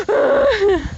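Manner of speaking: sharp gasp then a high loud shriek; startled by spiders all around; gasp and scream. Retold or imitated. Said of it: "So I, like, screamed".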